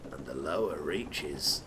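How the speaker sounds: hammed-up sinister chapter name; ominous deep title. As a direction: intone it like a grim trailer announcer.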